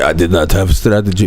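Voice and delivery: put-on very low, thick foreign accent; terrible Hamzat impersonation. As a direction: do a bad, deep-voiced fighter with accented English. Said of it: "doing a terrible fucking impersonation".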